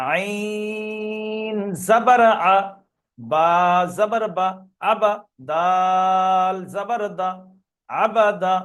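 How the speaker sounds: slow segmented spelling, "আইন" and "দাল" held long; letter-by-letter recitation building up to "আবাদা"; spelled out piece by piece. Shown as a demonstration. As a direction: break "আবাদা" into letters, stretching "আইন", keeping each "জাবার" syllable short. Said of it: "কেটে কেটে বানান করব".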